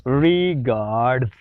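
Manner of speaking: रिगार्ड्स drawn out slowly, r left silent; slow careful demo. Shown as a demonstration. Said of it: "इसको सही pronounce करते हैं". (धीरे धीरे रिगार्ड्स)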